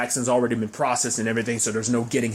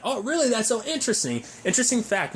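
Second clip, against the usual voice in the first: much higher, sarcastic fake enthusiasm; mock interest; 8 semitones higher than usual. Mocking a family history boast, sarcastic high voice. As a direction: act impressed with a mocking lilt. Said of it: "he's like".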